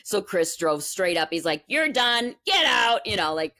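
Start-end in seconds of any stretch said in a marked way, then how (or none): 1.7-3.0 retold furious order, higher and louder; angry dismissal. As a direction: bark him out the door. Husband fires the rude teacher.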